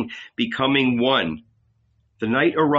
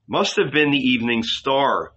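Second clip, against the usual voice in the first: ordinary delivery, nothing marked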